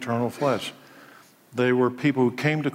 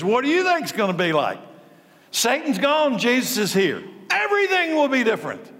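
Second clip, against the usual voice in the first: preaching at full force; 8 semitones higher than usual. Acted. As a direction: lift the congregation with every line.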